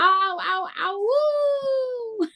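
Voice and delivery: high, drawn-out puppy howl; imitating child's dog howl. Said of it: "Penny thinks she's a dog".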